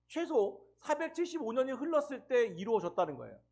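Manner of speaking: ordinary talk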